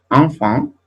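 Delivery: enfant with both nasal vowels, ɑ̃fɑ̃; French enfant. Shown as a demonstration. Teacher to class, modelling French enfant.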